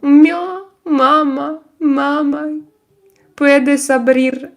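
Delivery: high mewing kitty voice; playful cat voice. Pretend. Streamer meowing for her cat, playful high mewing. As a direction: mew it like a cat calling.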